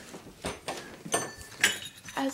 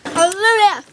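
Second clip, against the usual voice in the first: loud cheerful goodbye; 13 dB louder than usual. Real, not acted. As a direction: call it out loud. Loud outside.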